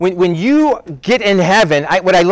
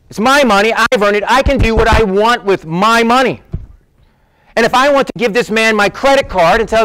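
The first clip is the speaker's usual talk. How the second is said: high, heated, insistent comeback; a man snapping back at a stranger over paying for food; upset retort; 4 semitones higher than usual. Retold or imitated. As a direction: defend your money with rising anger. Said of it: "I kinda said it like that".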